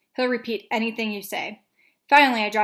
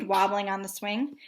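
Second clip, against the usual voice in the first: ordinary delivery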